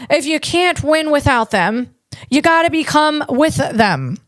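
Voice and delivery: carnival barker calling the crowd; vaudeville voice. Acted. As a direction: sell it like a vaudeville huckster. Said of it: "that's a vaudeville voice approach".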